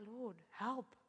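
low, hushed plea to God; retold murmur for help; helpless prayer. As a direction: pray it small and quiet, acting stranded. Retold helpless prayer, sitting alone.

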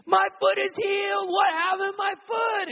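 very high, loud, astonished yell; high shouted "my foot"; amazed screaming. Retold or imitated. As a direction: shriek in disbelief at the healing.

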